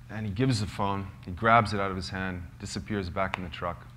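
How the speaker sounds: normal talking voice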